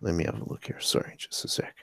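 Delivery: soft whispered aside to himself; muttering under breath. Real, not acted. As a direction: mutter it off-mic, almost whispered. Host still fixing screen share.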